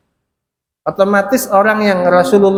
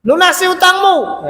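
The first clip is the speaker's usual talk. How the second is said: higher, sharp mock demand to repay a debt; angry demand; 7 semitones higher than usual. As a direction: play the angry creditor calling out. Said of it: "marah-marah kepada Rasulullah".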